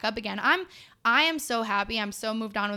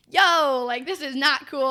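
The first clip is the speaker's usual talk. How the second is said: sharp, louder burst on yo; calling it out, half yelling; mock outraged yell; 5 semitones higher, 6 dB louder, slower than usual. To the gym that shut her down, mock outrage. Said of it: "So I'm like".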